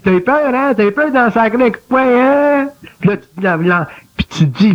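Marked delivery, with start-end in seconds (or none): 0.0-2.7 high, taunting inner voice; mocking little voice. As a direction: tease like a sneering inner critic. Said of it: "elle dit".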